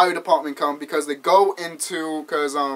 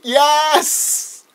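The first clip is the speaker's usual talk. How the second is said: high, drawn-out whoop of approval; gleeful cheer; 10 semitones higher, 4 dB louder than usual. Real, not acted. Fan cheering Chucky's line, gleeful cheer. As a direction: cheer it with relish.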